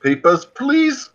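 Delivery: high, slow German officer; foreign guard voice. Pretend. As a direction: bark it like a border guard. Repeats guard demand.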